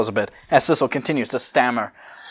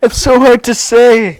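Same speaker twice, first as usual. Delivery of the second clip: high, loud, laughing wail; 11 semitones higher, 9 dB louder, slower than usual. Real, not acted. Laughing, can't say line.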